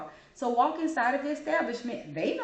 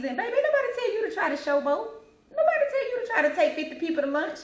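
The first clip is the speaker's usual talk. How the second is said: high, emphatic stress on nobody; sassy scolding; 11 semitones higher, faster than usual. Real, not acted. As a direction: call them out, pitched way up and pushing nobody. Scolding customers who showboat.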